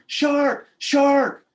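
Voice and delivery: high, loud shout of alarm; screaming in alarm. Retold or imitated. Girlfriend screams a shark warning to her boyfriend, screaming. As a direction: yell the warning as a retold scream.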